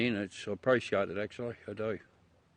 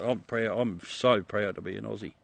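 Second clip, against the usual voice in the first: ordinary talk, nothing marked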